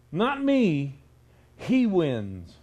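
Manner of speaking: ordinary talk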